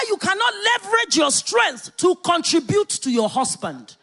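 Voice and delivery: preacher exhorting wives, loud and high; preaching at full force. Real, not acted. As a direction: drive it home to the women. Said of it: "preacher building to climax".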